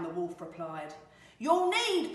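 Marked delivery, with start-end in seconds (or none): 1.4-2.1 high, loud piglet taunt; cheeky storybook pig; defiant little pig. Acted. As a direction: pitch it up and taunt the wolf. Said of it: "pig said".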